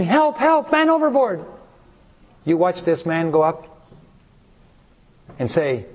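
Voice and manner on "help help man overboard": mock screaming. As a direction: call out as alarmed parents. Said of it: "The parents start screaming".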